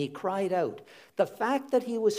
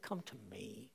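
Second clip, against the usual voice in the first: tender; 8 semitones lower, 15 dB quieter than usual. Acted. Tender quote of Jesus.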